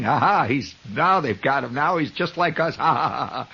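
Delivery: imitated jeering laugh between phrases; mock gossip laughter. Gossips laughing at the fallen doorman, mocking laughter. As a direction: laugh along like gloating neighbors. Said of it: "and they're laughing".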